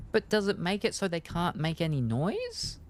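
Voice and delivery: high, puzzled query with a big lift at the end; puzzled question. Real, not acted. Player interrupting the game's text to ask, puzzled. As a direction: ask it high, rising sharply.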